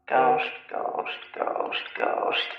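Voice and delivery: soft breathy ghostly whisper. Acted. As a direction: hush it like a ghost. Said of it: "not haunting enough".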